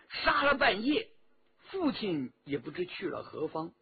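ordinary delivery, nothing marked

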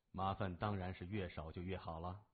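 low, quiet and even menace; cool gang boss. A narrator's reading. Gang boss answering the policeman's question, cool and low. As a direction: give the veiled order softly, low.

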